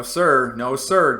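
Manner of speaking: ordinary talk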